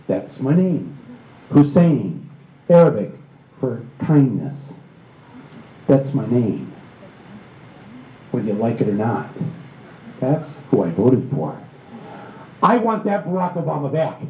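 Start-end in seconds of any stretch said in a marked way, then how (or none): 0.1-11.6 low, measured, resolute declaration; imitating a candidate standing firm, deep and unhurried. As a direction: drop the pitch and state it with deliberate pauses. Acting candidate's calm, defiant answer.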